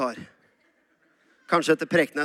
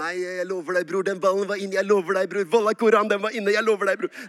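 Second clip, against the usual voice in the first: rapid-fire kebab-Norwegian dialect, building louder; kebabnorsk; 4 dB louder than usual. Shown as a demonstration. Boy arguing a goal at school football, heated kebabnorsk. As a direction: argue in Furuset schoolyard kebabnorsk. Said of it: "i klassen og sånn var det kebabnorsk".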